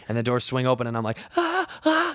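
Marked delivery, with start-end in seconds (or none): 1.4-2.1 two short high-pitched panicked cries; mock screams. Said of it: "and I'm screaming".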